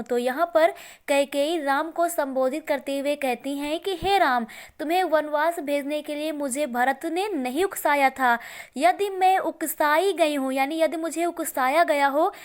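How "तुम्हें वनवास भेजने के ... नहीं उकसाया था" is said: normal talking voice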